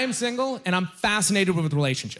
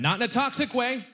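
higher, hasty correction; quick higher clarification; 8 semitones higher than usual. Acted. Comic correcting himself to the crowd, higher and hurried. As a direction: jump up to set the record straight.

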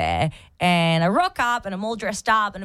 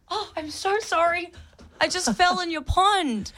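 embarrassed apology; 8 semitones higher than usual. Retold or imitated. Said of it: "And I said".

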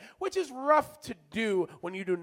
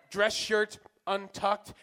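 ordinary talk

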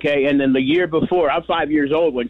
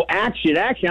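ordinary talk

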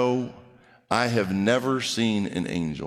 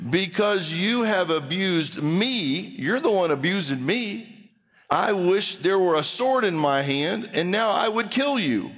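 indignant outburst; 7 semitones higher than usual. Acted. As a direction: draw out the accusation, pitched up in anger. Acts Balaam's angry threat to donkey.

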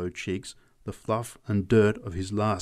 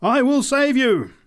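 very high, slow shouted promise; top of the voice; 9 dB louder than usual. A narrator's reading. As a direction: belt it out as a zealous preacher. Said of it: "at the top of her voice".

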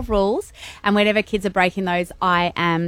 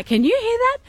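gentle parent voice. Retold or imitated. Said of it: "So I spend a lot of time saying".

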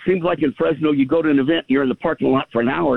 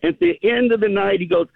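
normal talking voice

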